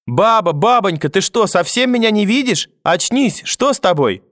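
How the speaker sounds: put-on high, anxious little-boy voice; panicked child. A narrator's reading. Grandson over collapsed grandmother, frightened and pleading.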